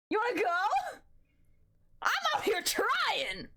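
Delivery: high, worked-up mock outrage; mock indignant challenge. Streamer squares up while struggling, mock indignant challenge. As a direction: protest in hammed-up exasperation.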